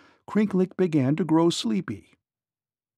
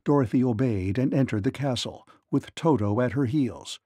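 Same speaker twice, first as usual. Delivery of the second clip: ordinary delivery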